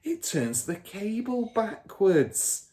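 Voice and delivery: put-on a smug car dealer bragging to his kid; Mr Wormwood's voice. A narrator's reading. Father character voice.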